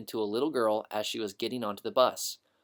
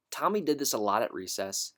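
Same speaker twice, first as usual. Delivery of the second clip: ordinary delivery, nothing marked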